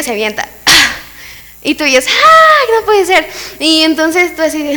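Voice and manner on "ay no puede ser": high-pitched alarmed gasp; mock-panicked, pitch leaping up then easing; acted shock. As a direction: cry out in hammed-up horror. Sees the child jump off swing.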